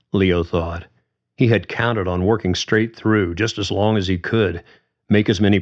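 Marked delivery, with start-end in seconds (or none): none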